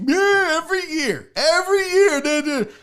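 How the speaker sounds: a mock whiny fan voice; mocking Yankees fan. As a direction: parody the crying fans. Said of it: "the Yankees fans cried like crazy".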